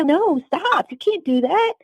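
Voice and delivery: high-pitched, urgent refusal; recalled objection, pitched well up; retold protest. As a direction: re-enact pushing someone away with your voice. Retold protest, pushing hand away.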